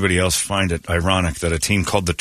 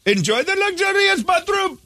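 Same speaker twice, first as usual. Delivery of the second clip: ordinary talk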